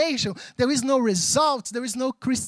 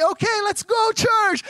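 joyful summons; 8 semitones higher than usual. Acted. Acts Jesus calling church to celebrate.

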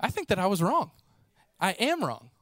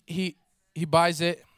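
normal talking voice

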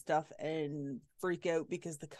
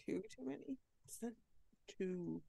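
soft, higher, puzzled question; 3 semitones higher, 12 dB quieter than usual. Real, not acted. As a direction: ask it back in disbelief. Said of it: "it was two too many".

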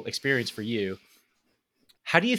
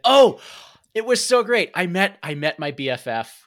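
gushing excitement; 6 semitones higher, 7 dB louder than usual. Retold or imitated. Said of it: "you came back and you said".

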